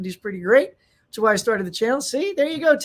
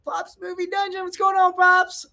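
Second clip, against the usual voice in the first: excited shout-out; 9 semitones higher, 3 dB louder than usual. Real, not acted. Greeting a viewer by name.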